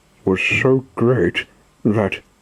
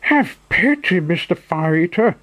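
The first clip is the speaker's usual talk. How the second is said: high pleading puppet voice, much higher; supplicating voice; 9 semitones higher than usual. Acted. Pinocchio begging the showman, high pleading. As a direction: beg for mercy in a high voice. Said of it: "in a supplicating voice".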